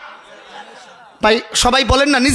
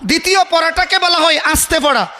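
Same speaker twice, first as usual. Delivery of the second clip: normal talking voice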